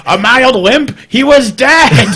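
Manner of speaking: high-pitched mock outrage, laughing; mascot voice. Joking host putting words in the little monster's mouth, high mock outrage. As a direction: push it high and exclaim in disbelief. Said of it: "you can see him, he's just saying".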